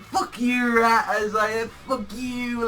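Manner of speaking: high-pitched taunt; mock gloating. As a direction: crow it at a beaten enemy. Acts out taunt at defeated rat.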